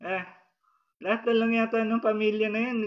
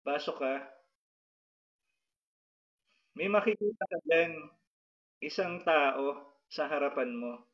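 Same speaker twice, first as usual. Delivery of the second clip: hypnotic healer's guidance; 2 semitones lower than usual. Acted. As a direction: lead the patient gently into a vision. Leads a trance induction.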